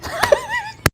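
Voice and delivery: high-pitched wheezy giggle; high laugh. Real, not acted. Laughing at her own impression.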